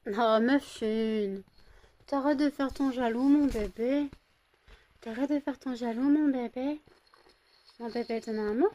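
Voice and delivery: high, sing-song pet talk. Real, not acted. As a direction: coo at a jealous puppy. Baby talk to jealous dog.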